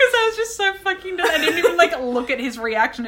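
laughing through the words, high and loud. Real, not acted. Telling a friend about the walkout, laughing. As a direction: giggle it out, barely holding it together.

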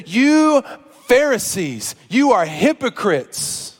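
slow, emphatic preaching, long-held key words; stern denunciation. Retold or imitated. Preacher as Jesus rebukes Pharisees before a crowd, stern denunciation.

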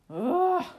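loud, high exasperated groan; frustrated sigh. A narrator's reading. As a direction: let out a great exasperated "ugh". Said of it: "great sigh of frustration".

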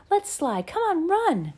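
high eager call; excited. Acted. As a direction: urge her on brightly. Laura urging little sister.

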